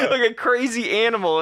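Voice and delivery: high laughing through the words. Real, not acted.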